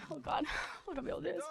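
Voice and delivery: hushed, fast muttered aside; quiet aside. Real, not acted. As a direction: drop it under your breath. Emotional aside mid speech.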